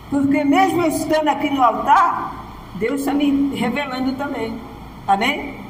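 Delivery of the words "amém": ordinary delivery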